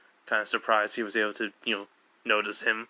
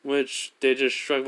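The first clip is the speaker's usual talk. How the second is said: plain reading voice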